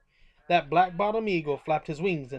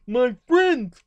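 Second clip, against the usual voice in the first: higher and louder, calling out with relief; joyful call; 7 semitones higher, 9 dB louder than usual. A narrator's reading. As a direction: call to found friends. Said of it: "called the elephant".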